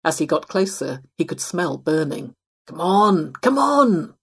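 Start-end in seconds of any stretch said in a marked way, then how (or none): 2.7-4.1 impatient, building louder and higher; urgent growl. A narrator's reading. Possum waiting on slow doors near the burning kitchen, urgent growl. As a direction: growl it, the repeat jumping up in urgency. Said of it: "he growled".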